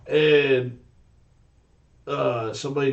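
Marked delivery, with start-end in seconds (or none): none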